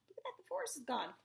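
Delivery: ordinary delivery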